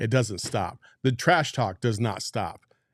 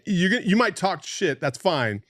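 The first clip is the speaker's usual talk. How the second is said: ordinary delivery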